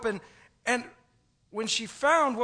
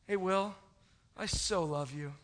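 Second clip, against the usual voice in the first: softer, lower, dreamy and sincere; tender; 3 semitones lower, 5 dB quieter than usual. Retold or imitated. Pastor telling a man friend he loves him, soft and tender. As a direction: say it like a love confession. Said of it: "That has a different meaning".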